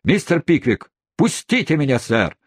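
high, indignant protest; shouting. A narrator's reading. Old man struggles against those holding him, shouting in protest. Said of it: "кричал старик".

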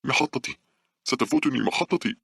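put-on high-pitched, panicky old woman's cry; sudden scream. A narrator's reading. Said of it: "صرخت العجوز فجأة". (بصرخة مفاجئة عالية الطبقة)

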